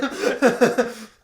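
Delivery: high unvoiced wheeze of laughter; loud wheezing laugh. Real, not acted. Laughing at own bad line, loud wheezing laugh. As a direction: burst into a breathless wheezing laugh.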